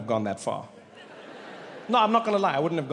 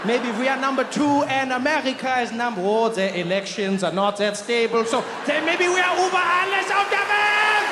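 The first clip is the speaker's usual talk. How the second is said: building to a shout; 6 semitones higher than usual. Acted. Comic's ranking spirals into a triumphant shouted anthem line. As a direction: build up until you shout it. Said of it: "escalating ranking to Über alles".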